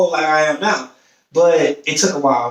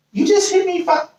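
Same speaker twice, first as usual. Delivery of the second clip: high, startled disbelief; he can't believe she's here already; mock shock at how fast she came; surprised; 13 semitones higher than usual. Surprised at her quick arrival.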